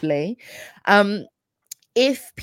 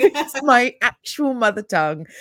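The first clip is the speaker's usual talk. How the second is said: laughing; 6 semitones higher, 5 dB louder than usual. Retold or imitated. Laughing indignant retelling.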